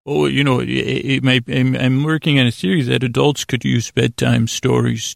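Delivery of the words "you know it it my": usual speaking voice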